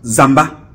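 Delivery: Zamba with an open "a" ending; prononciation ewondo. Shown as a demonstration. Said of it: "Les Ewondo". (prononciation ewondo de Zamba, finale en « ba »)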